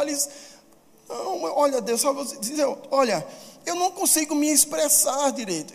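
high-pitched, incredulous protest; hammed-up flustered refusal, pitched high; protesting excuses. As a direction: plead your way out of a huge mission. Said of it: "um homem cheio de desculpas".

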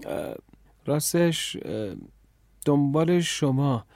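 halting, with fillers and gaps; hesitant. Acted. Boy admitting he was searching for her, hesitant. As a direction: stumble reluctantly through the answer. Boy caught out, answers falteringly.

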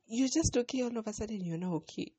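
a startled, half-laughing protest; disbelieving surprise. Retold or imitated. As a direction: exclaim it in amazed disbelief. Disbelief at mom's recovery.

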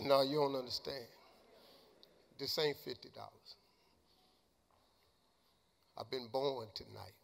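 low, hushed, choked-up retelling; retold tearful speech. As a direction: reenact being overcome, voice sunk very low and soft. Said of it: "I can't stop crying".

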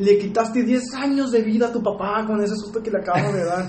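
high scolding mother voice; tearful mom. Retold or imitated. Mother scolding her son about his dad, high and upset.

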